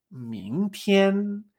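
明 rising, 天 held high and level; second-first tone pattern. Shown as a demonstration. Teacher reviews the combinations, 明 up and 天 high level. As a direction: climb on 明, sustain 天 up high. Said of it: "la dos uno".

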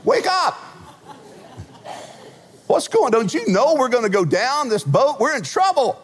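high, frantic cry to wake him; panicked shout. Retold or imitated. Simon Peter waking Jesus in the storm, high panic. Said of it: "Simon Peter ran up to him and went".

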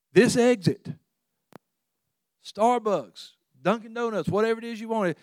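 road sign calling. Pretend. Sign luring drivers off highway, mock call. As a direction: announce the exits like a sign.

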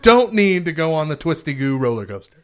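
loud, high-pitched comic tirade; worked-up, punchy complaint; exasperated rant. Real, not acted. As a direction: rant it out at full volume. Exasperated comic rant on roller coasters.

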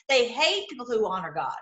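normal talking voice

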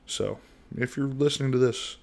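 ordinary delivery

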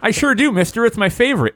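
put-on high, eager little boy; excited kid voice. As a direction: gush like a thrilled kid. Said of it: "exclaimed Fro".

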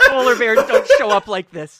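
very loud, high panicked protest; freaking out. Acted. Henchman reacts to a summoned polar bear, alarmed. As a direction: yell it in comic disbelief. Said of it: "and they freak out".

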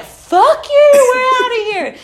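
high, loud re-enacted parting shot; screamed defiance. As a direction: yell it at them as you storm out. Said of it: "I'm screaming on your podcast".

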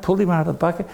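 ordinary delivery

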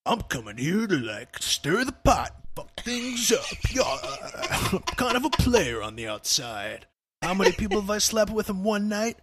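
cocky contestant, voice pushed away from his own; mock a brash villa contestant bragging; reality show contestant. Mimicking Love Island contestants' intro glamour shots, a cocky put-on voice. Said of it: "and they're like".

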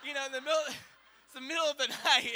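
high, laughing through the words. Real, not acted. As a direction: crack up mid-sentence. Laughing at the absurd scene.

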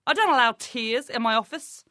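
normal talking voice

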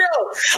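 squealing laugh; high laughing shriek. Real, not acted. Laughing mid-retelling.

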